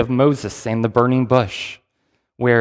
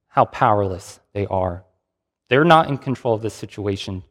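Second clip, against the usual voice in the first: ordinary talk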